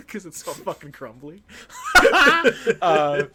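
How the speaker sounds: laughing till it squeaks; real helpless laughter. Real, not acted. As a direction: crack up mid-line. Cracking up at the joke.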